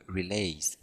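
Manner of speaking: slow French-accented English word; accent anglais approximatif. Real, not acted. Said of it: "mon superbe accent anglais". (accent anglais à la française, un peu lent)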